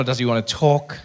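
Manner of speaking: ordinary talk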